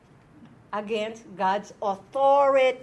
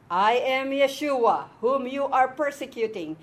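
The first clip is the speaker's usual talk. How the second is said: the Lord speaking; 2 semitones higher, 7 dB louder than usual. Acted. Teacher reading aloud the Lord answering Shaul, firm and loud. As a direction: proclaim it firmly, with weight. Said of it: "And the Lord said".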